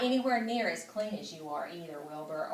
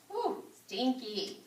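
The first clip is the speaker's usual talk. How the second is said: mock disgust; 3 semitones higher, 3 dB quieter, slower than usual. Real, not acted. Narrator jokes about the smell.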